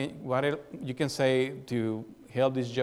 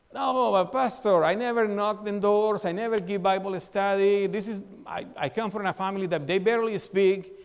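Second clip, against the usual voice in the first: higher, slowed pleading excuse voice; a churchgoer pleading excuses to the pastor, lightly whiny; mock excuses; 6 semitones higher than usual. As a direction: whine your way out of the task.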